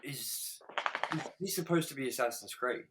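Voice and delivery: small, soft, halting question; hushed retelling of a doubtful question; quoting her, hesitant. Retold or imitated. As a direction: imitate her asking very quietly, with a false start. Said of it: "she goes".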